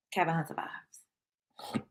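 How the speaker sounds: whisper. Shown as a demonstration. Repeats the line as a whisper.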